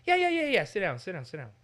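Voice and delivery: high, loud rapid yeah yeah then sit down; exasperated yes that means no; impatient yeah yeah. Shown as a demonstration. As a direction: brush them off with annoyance. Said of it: "But you just said, "Yes, you do mind".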